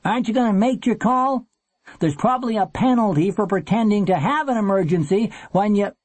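nagging call, pitched high; high, needling call to someone in the booth. A narrator's reading. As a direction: call over impatiently from the steps. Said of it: "the man called from the steps".

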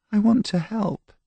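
very high, timid plea; small, hurt-sounding child; meek little girl. Acted. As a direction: plead in a tiny high girl's voice. Scolded girl pleads with soldier.

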